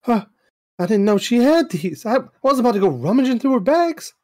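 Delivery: high, excited surprised voice; a flustered young woman caught off guard; voicing Cassie.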